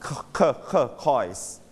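isolated "k-" repeated, then "coise"; Q as k sound. Shown as a demonstration. Teacher drills the Q in turquoise, repeated "k-" leading into "coise". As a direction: build "coise" from separate hard "k-" sounds. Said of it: "ตัว Q ออกเสียง k".

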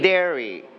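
"Diary" said as DAIR-ee, like dairy; correct word modelled. Shown as a demonstration. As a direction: model "Diary" with an opening like "dare". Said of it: "But this one, everybody say". (อ่าน "Diary" เป็น แด-รี)

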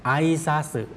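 "ไอซาสึ" with the first vowels blended; quick pronunciation. Shown as a demonstration. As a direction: say "ไอซาสึ" quickly as one joined word. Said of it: "แต่ออกเสียงเร็ว". (ออกเสียง "ไอซาสึ" เร็ว ควบเสียง)

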